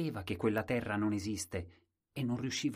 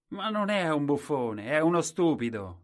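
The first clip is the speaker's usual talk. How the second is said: loud, jeering and higher; mocking crowd; 7 semitones higher, 9 dB louder than usual. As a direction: call him stupid with loud scorn. The crowd mocks Colombin.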